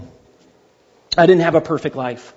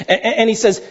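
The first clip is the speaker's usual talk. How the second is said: normal talking voice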